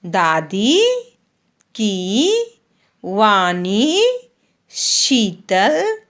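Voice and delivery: slow, sing-song primer reading, long vowels stretched; reading to children. Acted. Teacher reads the Hindi primer to young pupils, slow and sing-song. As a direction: read each word slowly for the class to repeat.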